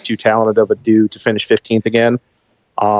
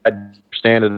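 choppy cutting-out line; bad connection. Real, not acted. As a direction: voice breaking up over a bad call. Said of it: "You're breaking up".

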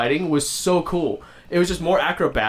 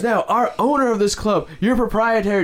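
higher, grand announcer lead-in; mock announcer build-up; 6 semitones higher than usual. Announcer presenting the club owner, mock grand build-up. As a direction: build up the big name.

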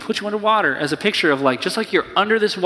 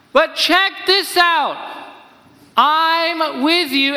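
much higher, intense, pressing voice that slows at the end; urgent call to the reader; 10 semitones higher than usual. Acted. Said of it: "It's like".

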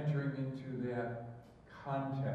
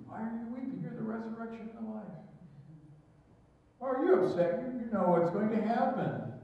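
pleading questions to Jesus; 5 semitones higher than usual. Pretend. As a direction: plead with Jesus, pitched up and urgent. Preacher voices imagined questions to Jesus.

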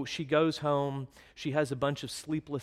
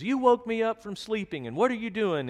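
put-on higher, louder scolding at the kids; scolding mother; 8 semitones higher, 5 dB louder than usual. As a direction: tell the children off sharply. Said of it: "She goes".

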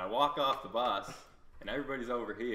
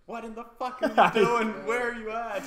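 much higher and louder, frantic mother; alarmed mom; 12 semitones higher, 9 dB louder than usual. Retold or imitated. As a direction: shriek it like a panicked mom on the phone. His mom on the phone.

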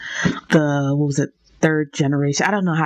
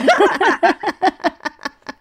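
high laugh; 12 semitones higher, 3 dB louder than usual. Real, not acted. Laughs at end of interview.

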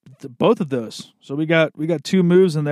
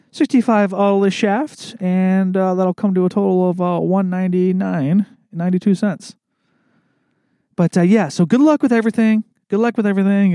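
higher customer service voice; Brittany; 4 semitones higher than usual. Acted. Coworker closing a golf order, imitated higher voice. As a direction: imitate a colleague reading back an order, pitched up. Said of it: "It'd be like my coworker, Brittany".

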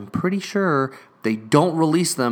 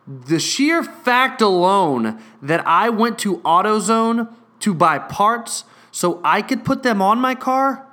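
slow, louder and higher, each word hammered; indignant rant; 5 semitones higher, 4 dB louder than usual. Real, not acted. Venting to viewers about the clerk, slow and louder. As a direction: lay it out slowly, seething.

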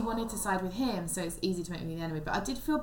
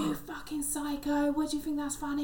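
higher, slowed-down furious snarl; mock angry woman; 7 semitones higher than usual. The angry date confronting the comic, a higher mock-furious snarl. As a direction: give a hammed-up furious woman. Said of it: "but she was so angry".

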